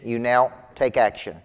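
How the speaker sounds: ordinary talk, nothing marked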